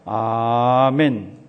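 sustained congregational amen; loud drawn-out amen. Real, not acted. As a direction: stretch the amen out slowly and strongly. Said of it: "큰 소리로 한번 봉독하십니다".

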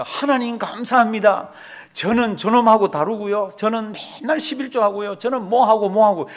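showy prayer. Acted. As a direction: pray to be heard by everyone passing. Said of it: "손을 들고 뭐라고 기도합니까".

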